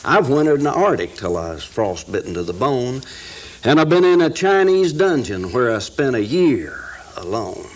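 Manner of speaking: reciting a rhyming poem with rising energy; poem recitation. Acted. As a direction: build each line like a campfire recital.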